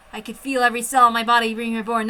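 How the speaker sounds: ordinary delivery, nothing marked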